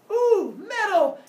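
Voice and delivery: high, loud, slow ooh; high thrilled kid voice; delighted. Retold or imitated. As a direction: gasp with greedy joy. Cyclops delighted.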